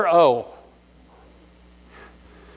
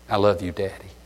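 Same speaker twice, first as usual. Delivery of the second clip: put-on much softer and lower, tender little girl; sweetest little-girl voice; 8 semitones lower, 7 dB quieter than usual. Little girl tells her father she loves him, very soft and tender. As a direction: breathe it out softly like a small child saying goodnight. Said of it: "in the sweetest voice says".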